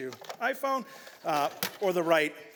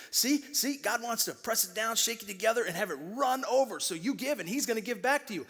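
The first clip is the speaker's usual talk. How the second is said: higher, eager sales-pitch preacher; mock prosperity preacher; 6 semitones higher than usual. Pastor mocking prosperity preachers, higher hyped pitch. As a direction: parody the slick preacher selling the promise. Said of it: "a go-to verse for a lot of prosperity preachers".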